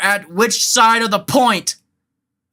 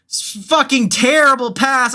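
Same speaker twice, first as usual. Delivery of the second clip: ordinary talk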